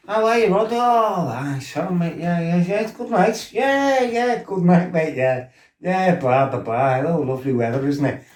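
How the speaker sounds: high, breezy, butter-wouldn't-melt hello; mock innocent greeting. To the lad he flattened, overly innocent high chirp.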